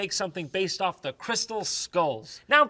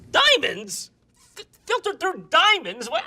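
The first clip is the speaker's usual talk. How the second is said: high, loud disbelief; can't believe the diamonds; incredulous; 10 semitones higher, 3 dB louder, slower than usual. Acted. Reacting to diamond filtering, incredulous.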